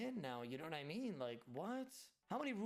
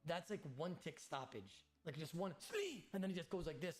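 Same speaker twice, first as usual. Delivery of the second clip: ordinary delivery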